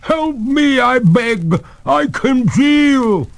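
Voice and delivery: very high, loud, slowing cry; freezing ghost begging for help; tearful plea. A narrator's reading. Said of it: "cried the ghost, a tear trickling".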